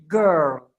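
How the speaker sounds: put-on "girl" as a long low gurr, final L barely there; drawn-out "girl" with a swallowed L; soft-ended girl. Shown as a demonstration. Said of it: "Bukan girl".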